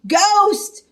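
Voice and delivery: panicked shout. Acted. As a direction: yell it in a cartoon panic. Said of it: "shouted Shaggy".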